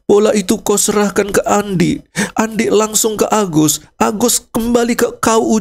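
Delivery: grieving mourner recounting the son's football game; grieving parent turned commentator. Acted. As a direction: wail over the dead son, then call the goal. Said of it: "semuanya nangis".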